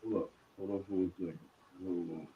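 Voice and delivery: muffled and faint; barely audible. Real, not acted. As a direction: voice buried behind bad audio. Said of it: "you sound muffled".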